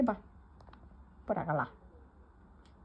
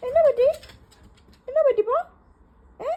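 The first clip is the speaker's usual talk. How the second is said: high, loud coaxing voice to the dog; high pet talk; 4 dB louder, faster than usual. Real, not acted. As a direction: ask the dog sweetly and loudly. (നായയോട് ഉയർന്ന കൊഞ്ചൽ ശബ്ദം)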